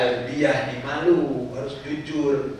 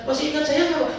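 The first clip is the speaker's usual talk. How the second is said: high, eager imitated question; eager pilgrim; 13 semitones higher, 5 dB louder, much faster than usual. Pilgrim asks ustaz to remember them, imitated in a high eager voice. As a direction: play the hopeful acquaintance.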